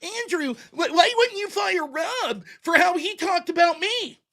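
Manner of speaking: a mock pleading voice aping the moderator; mocking whiny imitation. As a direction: mimic her pleading to Andrew, slow and whiny. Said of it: "she's out there running today like".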